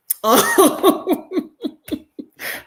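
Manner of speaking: high, loud drawn-out laugh. Real, not acted. Finishing her story to listeners, high loud laugh. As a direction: let out a long high laugh.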